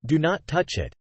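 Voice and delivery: ordinary delivery, nothing marked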